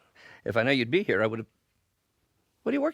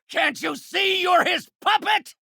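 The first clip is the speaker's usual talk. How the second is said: high, furious appeal mid-fight; exasperated shout; 13 semitones higher, 3 dB louder than usual. Acted. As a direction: yell it to make him see. Arguing in a fight.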